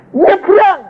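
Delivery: high, sudden call to cross; Moses commanding. Acted. Moses urges the people across the dry riverbed, high call. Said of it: "lalu Musa berkata".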